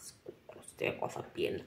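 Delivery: hushed conspiratorial whisper. Acted. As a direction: whisper it so nobody hears. Secret aside.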